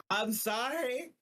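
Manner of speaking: high-pitched, apologetic plea; pleading apology. Real, not acted. Game master apologizes to fellow players for bringing this up, pleading apology.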